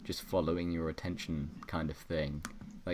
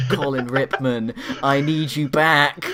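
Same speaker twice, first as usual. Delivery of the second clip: calling out; 8 semitones higher, 14 dB louder than usual. Pretend. Host begging the dropped character to come back, calling loudly. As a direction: cry out for him to return.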